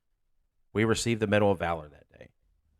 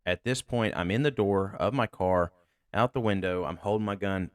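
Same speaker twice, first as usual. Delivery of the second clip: ordinary delivery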